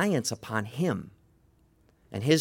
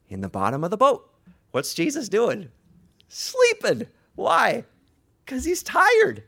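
loud, high-pitched answers; emphatic preaching; 10 semitones higher, 4 dB louder than usual. Real, not acted. Preacher answers own questions loudly.

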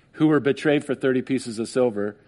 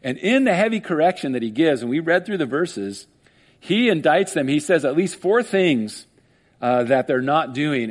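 ordinary delivery

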